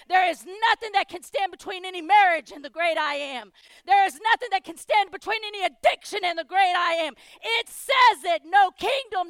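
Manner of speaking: loud, shouted preacher cadence; thundering pulpit declaration; full-force preaching. Acted. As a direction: hammer every line at the congregation.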